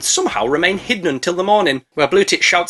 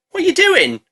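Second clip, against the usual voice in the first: much higher, friendly snake voice; polite snake. Retold or imitated. Narrator voicing the snake to Alfred, high and polite. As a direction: play the snake oddly courteous. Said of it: "the snake rocks up and is like".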